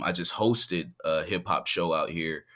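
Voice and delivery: ordinary talk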